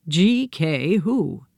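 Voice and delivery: pig's drawn-out puzzled question; slow pig voice. A narrator's reading. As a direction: let the old pig stretch it out. Pig answers the knock-knock joke.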